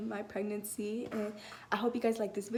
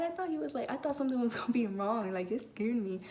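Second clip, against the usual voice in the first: usual speaking voice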